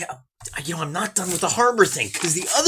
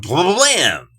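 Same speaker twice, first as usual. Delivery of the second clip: mock cannon fire; 3 semitones higher, 5 dB louder than usual. Mimicking cannon broadsides again.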